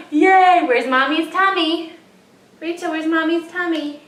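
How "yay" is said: cheering. Real, not acted. Cheering toddler.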